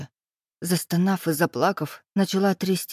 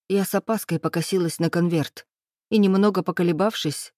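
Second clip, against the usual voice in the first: usual speaking voice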